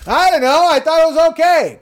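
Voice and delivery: screaming Bill. Retold or imitated. Correcting the quote to Bill's loud high scream. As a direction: shriek it like Bill did at the table. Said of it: "this is Bill".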